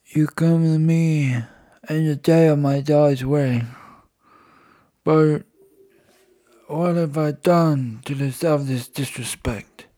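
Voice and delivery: slow mock mafia don; Godfather impression. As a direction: drag the words out like a wounded don. Quoting Godfather Brando line.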